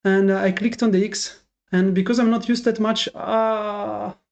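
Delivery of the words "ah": ordinary talk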